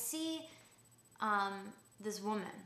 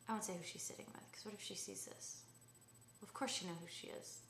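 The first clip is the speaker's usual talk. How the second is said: whispered worried aside; conspiratorial whisper; 9 dB quieter than usual. Real, not acted. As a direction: hush it like a secret to the camera.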